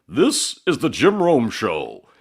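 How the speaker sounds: slow, booming radio announcer read; grand radio show intro; big announcer voice. Retold or imitated. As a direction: boom it out like a radio station open. Imitating the show's announcer open.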